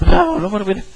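a little burst of acted alarm; panicked. Someone losing composure instead of keeping calm, mock panicked. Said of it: "He's not freaking out".

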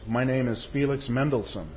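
ordinary talk

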